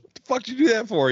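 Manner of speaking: high-pitched mock disbelief; acted incredulous question. Mexican passenger asking the Russian why, incredulous. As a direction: shoot the pitch up, playing baffled.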